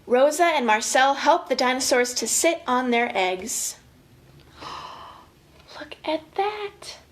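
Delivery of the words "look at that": slow, breathless whisper of wonder; hushed awe. Acted. To the kids, a slow whisper of wonder at the picture. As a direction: let it out in a slow amazed whisper.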